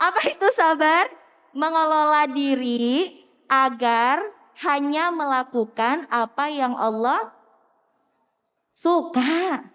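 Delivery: loud sing-song kindergarten teacher, slow answer; like teaching kindergarten. Pretend. Teacher mock-teaching adults as if they were kindergarten kids. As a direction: ham it up like a TK teacher. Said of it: "kayak ngajarin anak T K".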